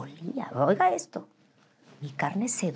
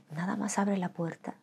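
softer tempting whisper; hushed coaxing inner voice; whispered temptation; 4 semitones higher, 7 dB quieter than usual. Retold or imitated. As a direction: lure her quietly to the door. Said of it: "mi carne me decía".

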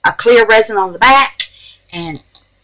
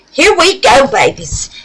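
"Here we go" high and loud, leaning on here; upbeat call; 7 semitones higher, 5 dB louder than usual. Real, not acted. Announcing start to viewers.

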